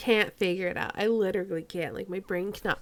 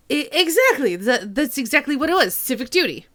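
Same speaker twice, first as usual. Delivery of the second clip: louder, stammering Cockney; 5 semitones higher, 8 dB louder than usual. Acted. Suspect seizing on the lieutenant's excuse, eager Cockney. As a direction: agree eagerly in a raised Cockney voice.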